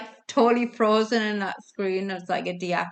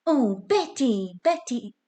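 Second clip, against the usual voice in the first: Frank Spencer; 4 semitones higher than usual. Shown as a demonstration. Quiz host attempting a sitcom impression for players, hammed-up whine. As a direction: draw it out and swoop up on the name. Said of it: "speak like Frank".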